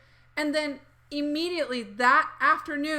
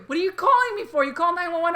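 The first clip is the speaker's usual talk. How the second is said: high, loud incredulous dad; dad scolding his daughter on the phone, high and exasperated; 6 semitones higher, 6 dB louder than usual. Retold or imitated. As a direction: scold like a stunned father.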